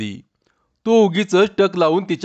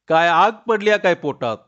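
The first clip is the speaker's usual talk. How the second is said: put-on teasing young wife; 3 semitones higher than usual. Chanda teases Tanya's hunger.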